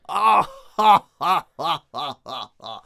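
loud, slow, theatrical belly laugh; stagey, drawn-out cackle; big panto laugh. Acted. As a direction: boom out a hammy pantomime villain laugh. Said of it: "does a big panto laugh".

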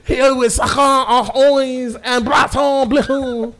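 slow, high, hammed-up French host; mock French accent. Podcaster introducing made-up co-hosts, exaggerated French accent. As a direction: stretch the fake names in a French accent. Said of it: "I have a French accent".

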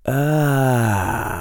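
a pleased, knowing ah; satisfied ah. A narrator's reading. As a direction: savour the moment. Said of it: "the voice whispered in satisfaction".

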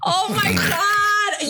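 high, drawn-out cry through laughter; laughing disbelief. Real, not acted. Friend watching the clip that settles the argument, laughing in disbelief. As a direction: wail it while cracking up.

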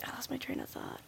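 real unvoiced whisper to oneself; whispered aside. Real, not acted. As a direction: drop to a whisper, thread lost. Aside on losing the thread.

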